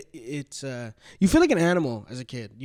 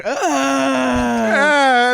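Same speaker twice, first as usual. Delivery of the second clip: high, loud mock wail of a kid; imitated cry; 9 semitones higher, 7 dB louder than usual. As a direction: wail like a bullied little kid. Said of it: "'cause I would, like, cry".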